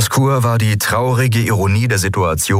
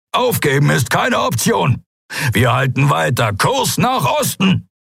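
high, forceful shout; defiant order, drawn out at the end; defiant command; 9 semitones higher than usual. A narrator's reading. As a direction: shout down any thought of surrender. Captain refuses to give up.

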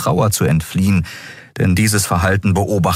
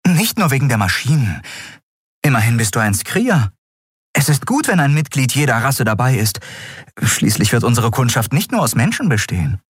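a gently raised, kindly woman's voice; lightly voiced Xea; 7 semitones higher than usual. A narrator's reading. Xea coaxing the Skria to join, lightly raised voice. Xea speaks in read story.

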